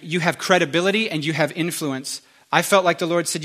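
ordinary talk

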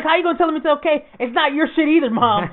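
high, fast indignant protest; exasperated mock yell at mom; retold heated argument. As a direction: rattle off the complaint, outraged.

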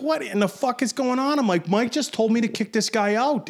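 high, exasperated retold protest; mock indignant, pitched well up; heated argument. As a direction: act out a bewildered bouncer mid-argument. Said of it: "we're starting yelling at each other".

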